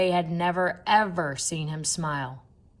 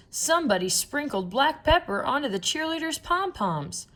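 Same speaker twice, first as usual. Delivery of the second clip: higher indignant fan voice; excited fan; 9 semitones higher than usual. A narrator's reading. Fan in the bleachers telling everyone, higher excited voice. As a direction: announce the discovery to the stands. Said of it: "shouted a fan".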